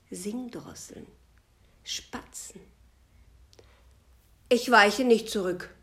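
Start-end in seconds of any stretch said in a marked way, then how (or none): 4.5-5.7 loud, indignant refusal; indignant cry. Acted. The sky refuses the birds, crying out indignantly. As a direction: plant your feet and cry out in outrage. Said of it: "rief der Himmel entrüstet".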